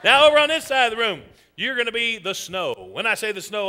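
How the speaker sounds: normal talking voice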